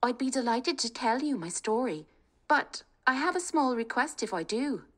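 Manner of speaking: rally the family, high and eager; spirited young woman. Acted. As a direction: bold daughter talking her family round. Kate voiced.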